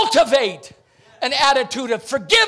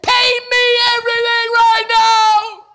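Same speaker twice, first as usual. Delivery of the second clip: angry demand; 11 semitones higher, 7 dB louder than usual. Acted. Creditor yells at the debtor who owes him. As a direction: shout it high and loud, like grabbing him by the throat.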